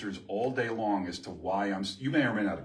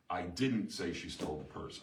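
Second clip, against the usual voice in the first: didnt pressed as a denial, the rest softer; stress on DIDN'T; 6 semitones lower, 5 dB quieter than usual. Shown as a demonstration. Teacher demoing a shift in meaning with stress on didnt. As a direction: deny it by leaning on didnt. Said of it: "say them in exactly the same order and mean seven different things".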